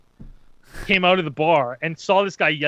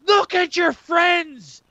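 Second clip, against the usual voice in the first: high, slow, roaring mock threat; yelling; 11 semitones higher, 4 dB louder, slower than usual. Mister Conspiracy to the guy held back by friends, yelling. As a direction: imitate a big drawn-out menacing shout. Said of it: "and he yells".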